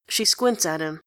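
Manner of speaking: ordinary delivery, nothing marked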